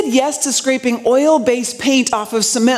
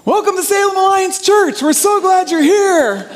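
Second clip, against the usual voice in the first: higher, put-on cartoon grape voice with a chuckle; 6 semitones higher than usual. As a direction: play the VeggieTales grandpa grape welcoming the church. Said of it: "my Pa Grape voice".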